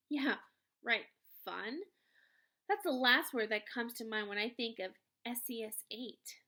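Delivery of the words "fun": ordinary delivery, nothing marked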